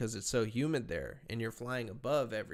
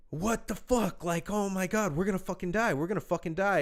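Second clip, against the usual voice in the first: retold panic; 7 semitones higher, 4 dB louder than usual. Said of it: "And I'm like".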